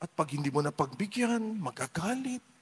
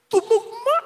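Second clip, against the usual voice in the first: high, loud shriek of disbelief; shocked joy; 8 dB louder than usual. Pretend. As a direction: yelp as the lotto numbers match. Lotto numbers match.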